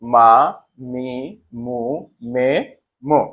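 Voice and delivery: "মা, মি, মু, মে, মো", each sounded out separately; syllable pronunciation. Shown as a demonstration. Teacher reciting the ma line for learners, syllable-by-syllable pronunciation of "মা, মি, মু, মে, মো". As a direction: sound out "মা, মি, মু, মে, মো" one by one with pauses. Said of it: "বর্ণগুলো উচ্চারণ হবে".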